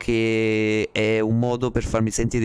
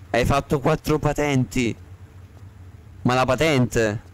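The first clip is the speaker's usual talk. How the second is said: ordinary talk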